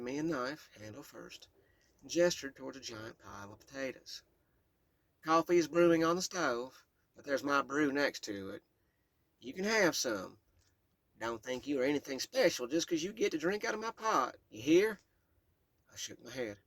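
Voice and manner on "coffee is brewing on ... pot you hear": put-on loud, higher no-nonsense Kentucky old woman; bossy but kind, Southern Kentucky accent; Southern Kentucky great-grandmother. Great-grandmother giving the child orders, Southern Kentucky accent. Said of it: "A deep Southern Kentucky accent".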